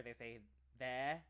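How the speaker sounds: non-rhotic British "there", with the R dropped and drawn out slowly; British accent. Shown as a demonstration. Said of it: "they don't say there".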